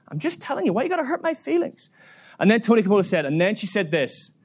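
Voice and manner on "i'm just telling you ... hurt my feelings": imitated hurt complaint, high-pitched; high, plaintive voice; defensive woman. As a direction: play a wounded woman protesting. Woman protests, feelings hurt.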